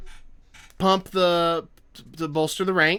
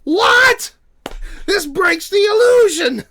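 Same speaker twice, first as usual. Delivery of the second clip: high, loud panicked shriek; mock panic; 13 semitones higher, 9 dB louder than usual. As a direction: cry out in horror at the cheap scenes. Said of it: "It's like".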